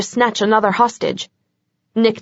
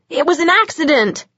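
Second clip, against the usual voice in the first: pitched up, desperate yell; yelling it was an accident; yelled plea; 7 semitones higher than usual. A narrator's reading. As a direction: shout it, pleading. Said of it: "he yelled".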